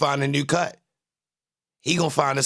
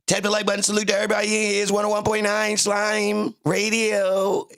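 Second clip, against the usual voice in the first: radio announcer; 9 semitones higher than usual. Pretend. Radio host sign-off bit.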